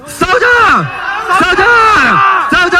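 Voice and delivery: rally the crowd to fight; rally battle cry. Real, not acted.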